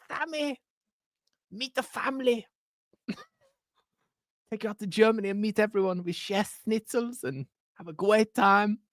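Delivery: high-pitched cooing manager with a mock German accent; doting Daniel Farke impression. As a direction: coo over him like a doting dad. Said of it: "I enjoy doing his accent too much".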